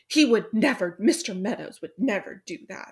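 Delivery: hammed-up protest, loud burst at the start; mock indignant defence. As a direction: play at being scandalised on his behalf. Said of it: "her character's supposed to be this widower".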